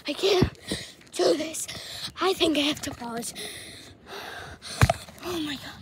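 winded, breaking for gasps; out of breath. Real, not acted. Runner needing to pause, out of breath. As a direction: pant it out, close to stopping. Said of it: "even though I'm out of breath".

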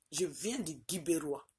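normal talking voice

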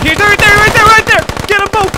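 high, loud panicked yell; frantic shout. Real, not acted. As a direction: scream the target out. Spotting enemy in firefight.

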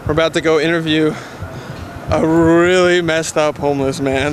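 low, loud, slow announcer delivery; intro voiceover. A narrator's reading. Warning viewers about a messed up homeless man, slow voiceover. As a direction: announce it slowly, low and forceful.

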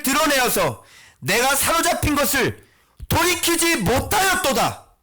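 high, insistent pulpit shouting; full-force preaching. Acted. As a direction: hammer out the accusation to the congregation. Preacher at full force, questioning congregation. (목소리 높여 질타하는 설교)

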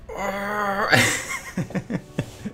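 high laugh breaking into staccato bursts; high-pitched laugh. Real, not acted. Gamer laughing at the match, high and stuttering.

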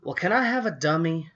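high, loud eager question. A narrator's reading. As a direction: ask hopefully and loudly. Chris asks eagerly.

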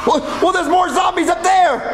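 high, drawn-out alarm; panicked warning. Acted. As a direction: shout a frightened warning. Spots more approaching zombies.

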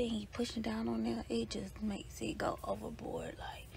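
barely audible whisper in a closet. Real, not acted. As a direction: whisper so she won't wake. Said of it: "I don't wanna wake her up".

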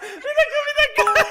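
high laughing through words; can barely speak for laughing. Real, not acted. Laughing at Smurf impression.

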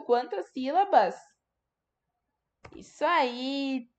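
ordinary talk

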